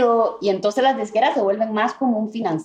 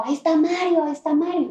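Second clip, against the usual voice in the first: retold shout; 8 semitones higher than usual. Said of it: "Alguien grita como".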